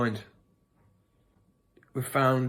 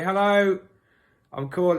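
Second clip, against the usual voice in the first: put-on phone voice; 6 semitones higher than usual. Shown as a demonstration. Prank caller phoning a stranger, lightly put-on phone voice. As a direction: lift the voice like a chirpy cold caller. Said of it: "This is an example of the kind of thing".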